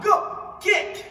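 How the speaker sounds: high, loud karate yell; kiai shout. Shown as a demonstration. Karate teacher shouting the kick call for students, loud. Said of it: "tu vas devoir dire kick".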